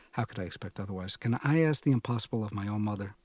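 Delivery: ordinary delivery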